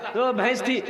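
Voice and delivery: normal talking voice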